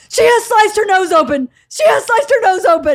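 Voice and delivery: high, loud alarmed report; panicked. Retold or imitated. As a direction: imitate a dad frantic over an injury. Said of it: "it scared Bert".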